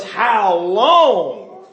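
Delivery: slow, loud, rising shout; preaching shout. Acted. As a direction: drag the words out in exasperation. Preacher exclaims at fools.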